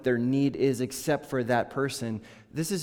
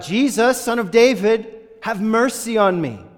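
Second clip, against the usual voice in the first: high, loud desperate plea for mercy; crying out; 9 semitones higher, 9 dB louder than usual. A narrator's reading. Blind beggar shouting to Jesus over the crowd, loud desperate plea. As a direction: call out to Jesus with everything. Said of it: "he cried out".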